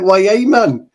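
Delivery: high-pitched Geordie catchphrase; mock Geordie accent. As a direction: put on a high, drawn Geordie lilt. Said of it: "Geordie's like".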